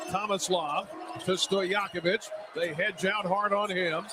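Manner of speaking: ordinary talk, nothing marked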